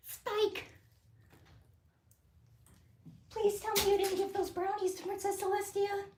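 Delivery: very high, bright cartoon pony voice; Twilight Sparkle voice. Streamer doing Twilight Sparkle for chat, very high pony voice. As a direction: squeak it out like a fussy cartoon pony. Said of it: "Twilight Sparkle impression".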